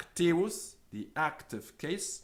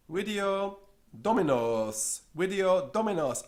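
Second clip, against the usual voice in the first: cameraman's answer; 7 semitones higher, 6 dB louder than usual. Acted. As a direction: answer with satisfaction.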